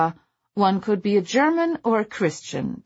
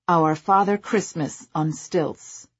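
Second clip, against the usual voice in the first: ordinary delivery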